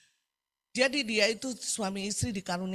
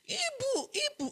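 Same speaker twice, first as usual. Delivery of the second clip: very high, soft mock call; thin high-pitched put-on call; imitated calling out; 4 dB quieter than usual. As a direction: imitate someone calling urgently from a distance. Woman runs up calling the preacher.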